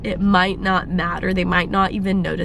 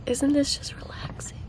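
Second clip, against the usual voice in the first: breathy hush, barely voiced; whispering; 5 semitones higher, 9 dB quieter than usual. Real, not acted. Whispering to viewers as the birds sing. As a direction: whisper it like a secret moment of peace. Hushed while watching sunrise.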